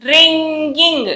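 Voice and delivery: రింగింగ్ very slow, "ng" held; NG sound. Shown as a demonstration. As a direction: stretch రింగింగ్ into ring-ging. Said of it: "జ్ఞా sound వస్తుంది చూసారా".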